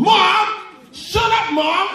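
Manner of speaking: high bratty yell at mom; shrill mock tantrum from a child; kid shouting back. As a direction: scream it at your mother like a spoiled kid. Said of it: "He shouted back at his mom".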